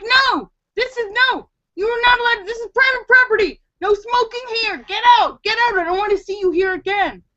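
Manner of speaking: high, indignant ordering-out; retold telling-off. Retelling kicking the intruder out.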